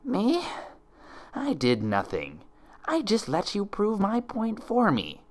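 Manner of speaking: high, slow, mocking double; sneering impostor. Impostor to helpless Twilight, high, slow and mocking. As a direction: savour every word of the taunt.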